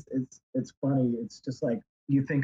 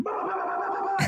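much higher silly babbling; mocking babble. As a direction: mimic someone high on drugs rambling nonsense. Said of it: "going like".

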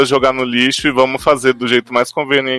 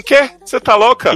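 higher, incredulous "quê?"; half-joking outrage; mock disbelief; 11 semitones higher, 4 dB louder than usual. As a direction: act shocked, as if she's lost her mind. Reacting in disbelief to Mary Poppins.

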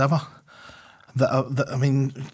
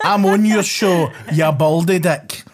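loud, higher, drawn-out comeback; defiant retort; 4 semitones higher, 6 dB louder, slower than usual. Acted. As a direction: throw the insult back, slow and loud. Defiant retort to texted insult.

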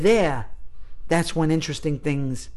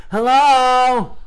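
loud, high mock hello; drawn-out call; oblivious calling out; 8 semitones higher, 5 dB louder than usual. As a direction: holler it like the clueless student. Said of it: "was like".

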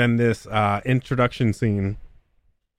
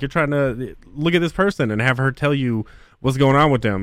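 ordinary delivery

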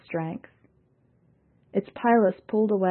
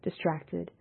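ordinary delivery

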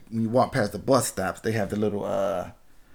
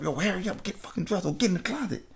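higher and softer, a hurried whisper-ish push; hushed urgent order; 7 semitones higher, 7 dB quieter, faster than usual. Retold or imitated. To the girl hiding from mom, hushed urgent hurry. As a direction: hiss it so mom won't hear.

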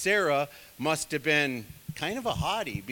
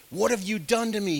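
demanding; 6 semitones higher, 6 dB louder than usual. A narrator's reading. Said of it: "he demanded".

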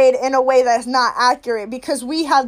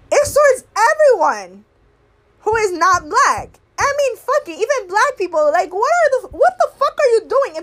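exasperated outburst; 7 semitones higher than usual. Real, not acted. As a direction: pitch flies up, exasperated and incredulous. Rant peaks in exasperation.

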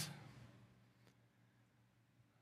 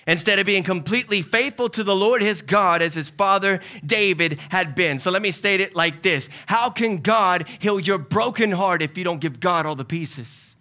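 preaching; 5 semitones higher, 5 dB louder than usual. Real, not acted. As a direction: drive the point home from the pulpit. Preaching at full force.

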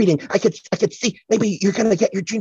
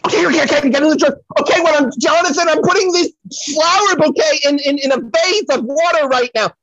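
loud, high shout to the next room; calling offstage; 10 semitones higher, 6 dB louder than usual. Acted. Psychic yells to John offstage about the vase. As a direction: yell it toward the other room.

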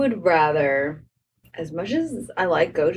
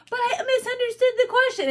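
whiny protest; 14 semitones higher than usual. Retold or imitated. Student protesting a wrong answer to the teacher, mock whining. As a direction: plead like a wronged student, hammed-up.

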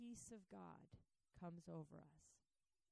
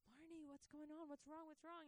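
high, concerned questions; worried husband; 9 semitones higher than usual. Retold or imitated. Husband waking wife from a bad dream, worried and higher. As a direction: check on her, alarmed. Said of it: "my dad would be like".

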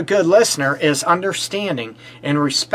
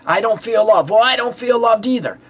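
higher, bickering back and forth; mock quarrel between partners, pitched up; arguing couple; 6 semitones higher than usual. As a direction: toss the line back like a sulking partner. Acted couple's tit for tat.

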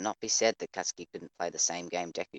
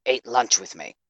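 demanding; 5 semitones higher, 10 dB louder, slower than usual. A narrator's reading. Blunt demand to rival.

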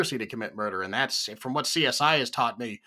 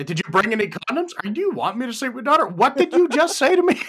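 laughing dad-interrogation voice, rising higher; gruff overprotective dad; 9 semitones higher, 3 dB louder than usual. Retold or imitated. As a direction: grill him like a suspicious father. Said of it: "leaning into the question".

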